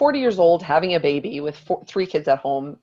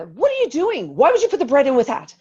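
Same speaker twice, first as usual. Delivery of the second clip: high, loud exasperated scolding; acted indignant nagging, high and forceful; retold angry outburst; 8 semitones higher, 6 dB louder than usual. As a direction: re-enact snapping at a husband, pitched way up. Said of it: "went completely down a rabbit hole".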